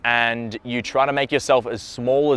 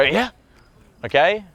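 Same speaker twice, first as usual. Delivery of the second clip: quick, startled "Yeah" pitched up; jumpy reply; 4 semitones higher than usual. Shown as a demonstration. Reacting to someone yelling your name, jumpy. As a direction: answer as if jolted by your name. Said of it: "you're not gonna go".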